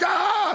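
sudden high-pitched "Ah!" of a wired, overcaffeinated person; mock wired yelp; frantic yell. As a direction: burst out in a jittery cry. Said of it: "and you're like".

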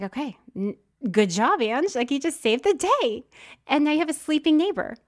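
mock cheer. Sarcastic self-talk to sleeping seatmate.

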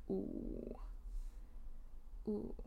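a whispered mock-scared gasp of worry; scared ooh. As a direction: breathe out a frightened little ooh. Imagined scared reaction to nosebleed.

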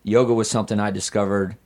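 normal talking voice

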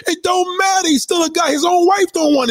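real exasperated yelling; high angry shout. Real, not acted. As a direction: shout him down. Angry rant about a celebrity.

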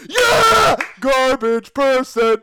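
high shouted jeer, drawn out; heckling shout. Pretend. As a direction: jeer it like a rowdy crowd. Jeering from the gallery.

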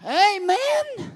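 high, loud amen; shouted amen. Real, not acted. Preacher closing his outburst, loud amen. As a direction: cap the climax with a cry. Sermon climax.

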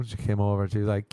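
usual speaking voice

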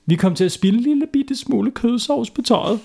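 put-on high little-boy voice; sheepish boy. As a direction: squeak out the confession. Boy confesses to doctor.

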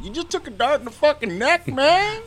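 high, rising movie quote; movie quote impression. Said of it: "I liked him the best in that".

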